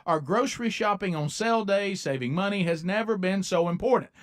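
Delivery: upbeat radio ad read. Acted. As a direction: sell it like a host-read spot. Host reads a sponsor ad.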